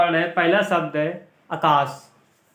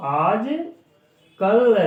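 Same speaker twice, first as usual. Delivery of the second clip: आजकल drawn out very slowly, a bit higher; slow dictation; 5 semitones higher, 4 dB louder, much slower than usual. Shown as a demonstration. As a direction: stretch आजकल for pupils to copy. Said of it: "ठीक है बच्चों".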